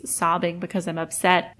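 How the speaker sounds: usual speaking voice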